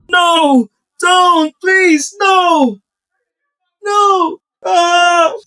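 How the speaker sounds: high, loud panicked screaming; screaming plea. Acted. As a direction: scream as you are dragged off. Dragged away, pleading and crying.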